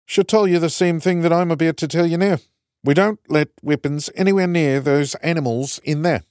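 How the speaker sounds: put-on broad kiwi accent, firm; stern kiwi prison guard; New Zealand accent. A narrator's reading. Kiwi guard lectures Strat.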